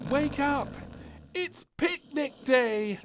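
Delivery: high, loud, slow calling out; excited cry. A narrator's reading. Princess rushes in waking her parents, high excited cry. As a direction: burst in and wake everyone up. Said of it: "cried the little princess".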